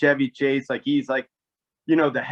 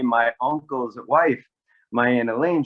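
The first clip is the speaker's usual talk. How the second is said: normal talking voice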